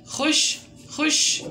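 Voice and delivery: खुश stretched slowly and breathily, high; slow drawn-out reading. Shown as a demonstration. Teacher reading खुश aloud slowly for the class. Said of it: "एक दफ़ा पढ़ लेते हैं".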